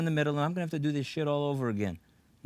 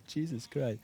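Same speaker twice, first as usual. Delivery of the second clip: usual speaking voice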